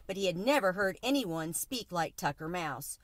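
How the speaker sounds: usual speaking voice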